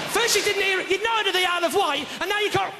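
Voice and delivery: high-pitched exasperated scolding; mock-angry telling-off. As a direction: berate them in a high, incredulous voice. Berating audience member for bad answer.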